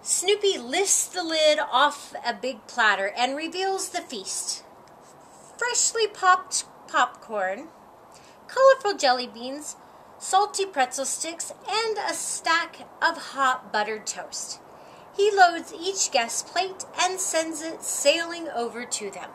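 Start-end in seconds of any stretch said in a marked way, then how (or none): none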